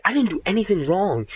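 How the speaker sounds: high exasperated protest. Retold or imitated. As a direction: pitch up in exasperation. Retold protest to the dean.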